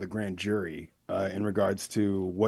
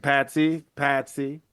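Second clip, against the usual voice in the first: higher-pitched calling of a name; mildly insistent retold call into the phone; 5 semitones higher, slower than usual. Operator calling to caller who left phone, retold.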